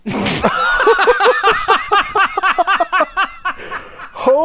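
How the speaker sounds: high wheezing burst of laughter; can't stop laughing; hysterical laugh. Real, not acted. Shocked after prank call.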